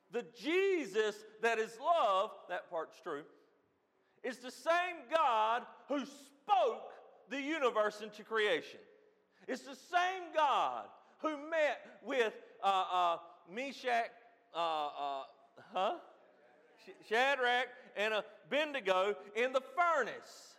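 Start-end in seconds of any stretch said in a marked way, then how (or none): 0.1-2.3 loud, raised, slow proclamation; impassioned preaching. Real, not acted. Preacher correcting the congregation's view of Jesus, impassioned. 4.2-20.5 high, loud pulpit proclamation; full-force preaching. Real, not acted. Preacher insisting to the congregation God never changed, full-force preaching.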